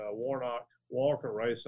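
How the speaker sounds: usual speaking voice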